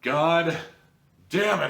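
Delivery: usual speaking voice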